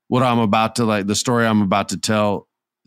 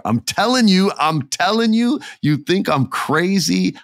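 emphatic insistence; 9 semitones higher than usual. Real, not acted.

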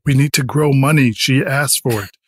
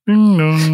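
doorbell chime; 4 semitones higher than usual. Acted. Doorbell sound imitated.

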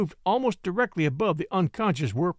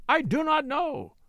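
higher, drawn-out pleading cry; slower desperate repeat; 9 semitones higher than usual. A narrator's reading. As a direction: drag out the denial, begging. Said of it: "cried Werper".

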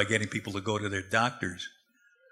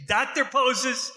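slow, much higher, laughing catchphrase; grand catchphrase; 13 semitones higher, slower than usual. Retold or imitated. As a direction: ham it up like a TV doctor ad slogan, drawn out. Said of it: "the way I say it".

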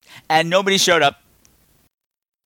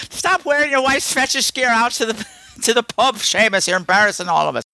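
mock Irish pub scold; 8 semitones higher than usual. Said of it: "So it'd been like".

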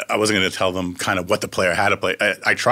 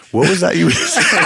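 high, loud laughing delivery; laughing apology; 9 semitones higher, 6 dB louder than usual. Retold or imitated. Dan admitting he wasn't listening, laughing. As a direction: laugh through the punchline.

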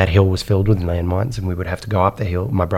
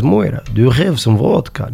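mock Russian accent, pitched up; Russian nun; 5 semitones higher than usual. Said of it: "let's say this nun was just Russian".

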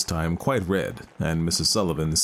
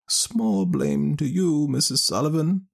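put-on high, slow wise old woman; a knowing village wise woman; 10 semitones higher, slower than usual. Ellen Leah answers.

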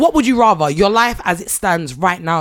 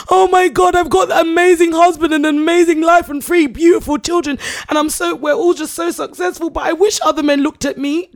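high, gushing mock whine; mocking imitation; 7 semitones higher than usual. Co-host mimicking a wife begging for attention, mocking. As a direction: put on a higher, self-pitying voice to ridicule her. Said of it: "She's like".

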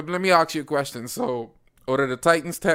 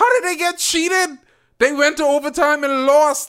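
incredulous; 13 semitones higher, 6 dB louder than usual. Real, not acted. Incredulous objection in argument.

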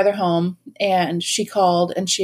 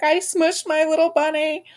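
mock crying; 8 semitones higher than usual. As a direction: imitate a tearful late-night confession. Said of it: "crying at three A M".

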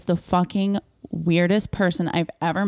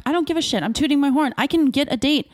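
higher, heated mock telling-off; a hint of defiant bragging, pitched up; indignant retold rant; 8 semitones higher, faster than usual. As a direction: tell him off like you're still fuming.

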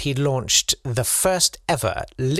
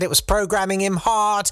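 ordinary delivery

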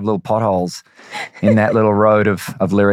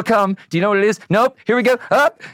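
rising higher and higher; building tension; 12 semitones higher than usual. Retold or imitated. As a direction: climb in pitch with suspense. Reliving blank moment on stage.

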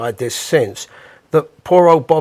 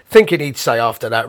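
usual speaking voice

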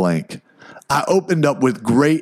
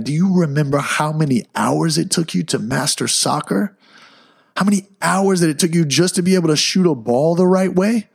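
imitating wife's insistent questions, pitch rising higher; chiding pep talk; 5 semitones higher than usual. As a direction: challenge him with pointed rhetorical questions. Wife chides him with pointed questions.

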